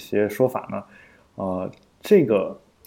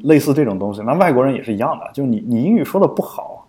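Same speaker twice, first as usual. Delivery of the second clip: normal talking voice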